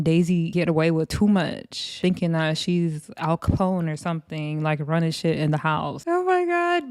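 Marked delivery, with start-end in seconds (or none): 6.1-6.9 high, thrilled exclamation; real surprise and delight; squealed out in delight; delighted gasp. Real, not acted. Reacting to [name]'s comeback.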